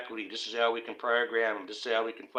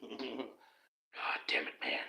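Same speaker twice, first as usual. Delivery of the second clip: breathy, very slow mock curse; half-laughed whispered curse; laughing whisper; 10 dB quieter, much slower than usual. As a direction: chuckle it out under your breath.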